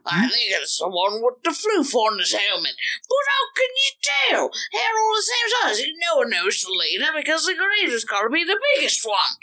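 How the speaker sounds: bad Cockney orc, climbing to a high yelp; high-pitched, over-the-top fake Cockney; horrible Cockney accent. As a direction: squabble like an orc in hammed-up Cockney. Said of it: "absolutely horrible Cockney accent".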